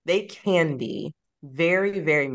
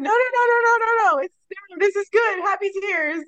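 mock teary protest, much higher and louder; happy tears; 3 dB louder than usual. Author reassuring her tearful reader, half-crying in a very high voice. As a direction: gush through happy tears, pitched way up. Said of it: "I was like".

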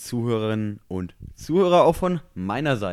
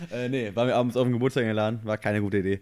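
ordinary talk

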